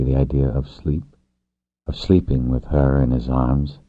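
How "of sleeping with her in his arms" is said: ordinary delivery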